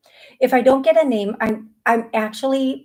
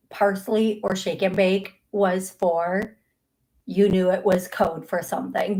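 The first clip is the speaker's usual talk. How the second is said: ordinary delivery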